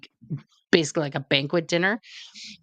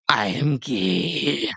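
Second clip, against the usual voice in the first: drawn-out, slightly lowered confession; slow deadpan admission; 3 semitones lower, much slower than usual. Retold or imitated. Admitting it after twelve married years, slow and drawn out. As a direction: stretch each word as a comic realisation.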